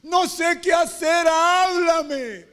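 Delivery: high, shouted, drawn-out plea; long wailing shout, begging for an answer; tearful cry to God. Acted. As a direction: cry out desperately in acted prayer.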